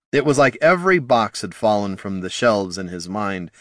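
usual speaking voice

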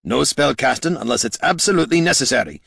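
lightly voiced gruff dwarf; a hint of a grumpy dwarf. A narrator's reading. Gorm character voice.

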